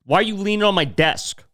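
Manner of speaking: retold confrontational question; imitating angry boss. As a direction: act out a boss scolding staff. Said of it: "just yell at people".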